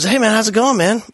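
high, easygoing imitation of a student; cheery kid greeting. Oblivious student greeting the teacher who is mad at him, cheerily.

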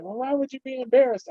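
wife's question. Retold or imitated. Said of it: "my wife's like".

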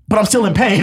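high-pitched comic complaint; mock crying protest. Patient objecting to the nurse's comfort, mock tearful. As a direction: protest in a hammed-up high whine. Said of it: "crying so much".

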